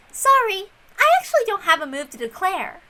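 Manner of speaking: a hint of the haughty girl detective; Erica. Erica's confession to the Game Master.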